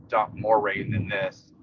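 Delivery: ordinary talk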